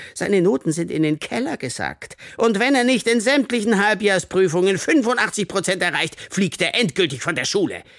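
high, loud, indignant warning; angry threat. A narrator's reading. Teacher threatens pupil with expulsion, angrily. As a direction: lay down the ultimatum heatedly.

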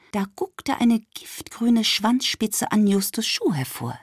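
plain reading voice

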